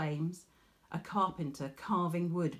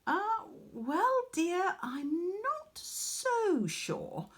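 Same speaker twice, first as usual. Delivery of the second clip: doubtful mother; 13 semitones higher, much slower than usual. Acted. Mother doubting Arachne's boast.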